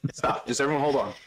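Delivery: usual speaking voice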